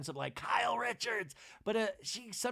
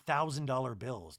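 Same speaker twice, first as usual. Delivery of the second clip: ordinary talk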